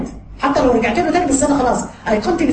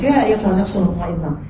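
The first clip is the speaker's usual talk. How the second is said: Quran recitation; 6 semitones lower than usual. Acted.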